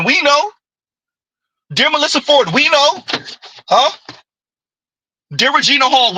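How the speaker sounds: a knowing, mocking chant of we know; taunting refrain. Real, not acted. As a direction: tease each name with a knowing we know. Teasing refrain to celebrities.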